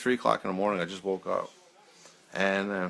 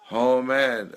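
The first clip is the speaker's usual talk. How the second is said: ordinary talk, nothing marked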